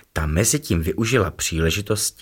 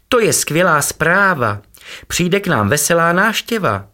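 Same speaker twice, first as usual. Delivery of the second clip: much higher, louder joy; delighted; 10 semitones higher, 4 dB louder than usual. A narrator's reading. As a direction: announce great news gleefully. Tupo happy about visit.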